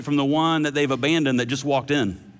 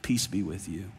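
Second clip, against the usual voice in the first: hushed and soft, a calm peace over frightened men; gentle whispered blessing; 11 semitones lower, 10 dB quieter than usual. Acted. Jesus greeting his fearful disciples, hushed and tender. Preacher voices the risen Jesus.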